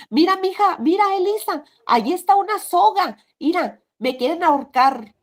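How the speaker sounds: high, loud frightened pleading; a dying man's terrified cry about a noose; dying husband panicking over a noose he sees; frightened, seeing things. Retold or imitated.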